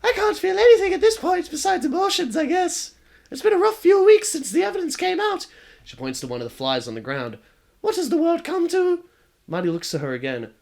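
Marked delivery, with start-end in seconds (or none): 0.0-5.5 put-on very high, louder girl voice with a wry edge; the ghost shrugging off her fate; high wistful ghost girl. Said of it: "The ghost laughs again". 7.8-9.0 put-on very high, louder girl voice with a wry edge; the ghost shrugging off her fate; high wistful ghost girl.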